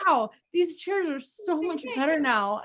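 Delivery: high-pitched mock wonder; put-on delighted discovery; imitating amazed husband. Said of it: "he's like".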